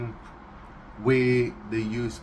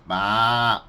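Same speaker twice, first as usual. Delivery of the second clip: loud baa bleat; sheep bleat; 3 semitones lower, 6 dB louder than usual. Shown as a demonstration.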